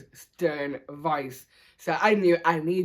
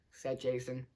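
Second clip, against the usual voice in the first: plain reading voice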